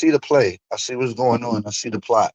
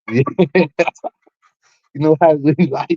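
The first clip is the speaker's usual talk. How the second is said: normal talking voice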